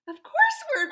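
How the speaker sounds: hammed-up thrilled squeal; mock giddy excitement. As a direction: gush like you always knew it. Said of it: "then we're like".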